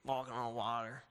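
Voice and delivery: mock-bored so-what voice; bored shrug. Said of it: "you're like".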